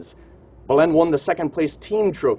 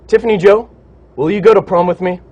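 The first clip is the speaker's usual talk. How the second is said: asking someone out; 4 semitones higher, 9 dB louder than usual. Real, not acted. On-air prom invitation to classmate.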